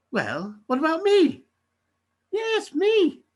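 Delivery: high, loud hopeful pitch; excitedly insisting on yourself; eager self-promotion. Acted. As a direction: put yourself forward, voice leaping up. Agent eagerly offers himself as an act.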